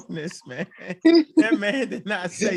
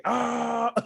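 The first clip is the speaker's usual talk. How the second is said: mock battle cry; 6 semitones higher than usual. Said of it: "they be like".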